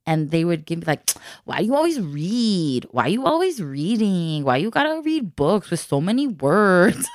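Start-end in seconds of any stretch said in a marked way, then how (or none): 1.5-7.1 taunting sing-song imitation of their accent; imitating classmates' accent. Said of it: "they sounded like that".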